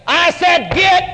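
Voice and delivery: firm command to the dog. Retold or imitated. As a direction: say it like you mean it. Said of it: "with authority in your voice".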